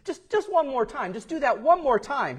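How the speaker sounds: high-pitched acted begging; imitating an eager reporter's plea; pleading. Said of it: "Well, he pleaded".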